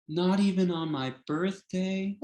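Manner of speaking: a hint of a hurt, teasing grandmother; playful grandma voice.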